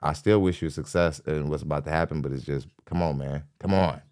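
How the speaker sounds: ordinary talk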